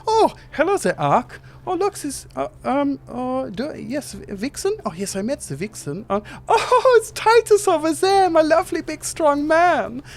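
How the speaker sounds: squeaky little genie gushing over Titus; small Germanic genie. Acted. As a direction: play a tiny fussy genie, flustered. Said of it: "small Germanic genie appears".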